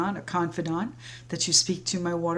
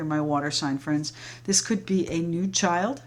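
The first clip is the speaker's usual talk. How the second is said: normal talking voice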